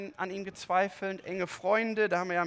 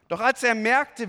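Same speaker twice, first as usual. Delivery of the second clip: normal talking voice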